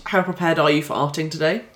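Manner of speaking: ordinary delivery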